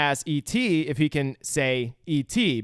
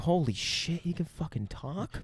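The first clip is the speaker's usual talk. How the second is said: hushed, soft imitation of disbelief; mock astonishment; 2 semitones higher, 8 dB quieter than usual. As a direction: breathe it out in acted amazement. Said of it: "Elliot is like".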